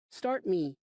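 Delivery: put-on a small girl asking her dad for a push; little girl voice.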